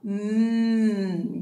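"Mm" hummed as a long nasal mm; the n sound. Shown as a demonstration. Teacher modelling the letter N sound "Mm" for the class. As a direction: hum "Mm" for the n sound, lips closed. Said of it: "put your tongue on the roof of your mouth".

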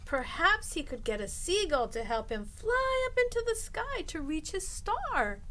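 ordinary delivery, nothing marked

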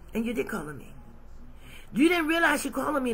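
usual speaking voice

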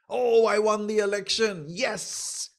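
acted jubilant celebration; mock triumph. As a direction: crow like an election winner, hammed up. Acts out a gloating election winner.

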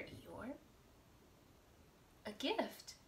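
ordinary delivery, nothing marked

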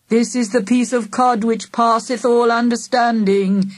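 slow churchy Geordie-free intoning; mock solemn intoning. A narrator's reading. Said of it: "he intoned".

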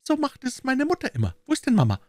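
put-on very high little-child voice; small girl explaining, then asking for her mother; high-pitched kid, voice up on the question; five-year-old girl. A narrator's reading. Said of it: "ein etwa fünfjähriges Mädchen".

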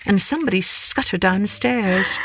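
plain reading voice

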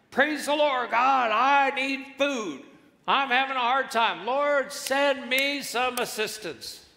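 high, loud, imploring prayer; old lady praying aloud. Acted. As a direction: cry out to God as a devout old lady. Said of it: "So she prayed to God to send her assistance".

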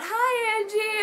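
put-on kid yelling hello. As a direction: call out like an excited little boy. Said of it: "this random fluffy-haired kid yells out".

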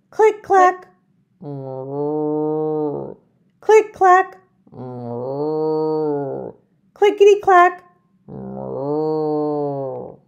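singsong typewriter and cow; click clack moo. Acted. Typing sound and cow moo.

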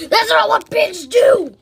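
loud, high, slow protest; mock outrage at a bad impression; indignant shout. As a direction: yell it, offended. Arguing about pig noises.

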